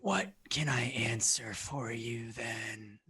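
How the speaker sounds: breathy, raspy maze creature; rasping, wheezing creature. Acted. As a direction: answer in a wheezing rasp. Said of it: "rasping, wheezing voice".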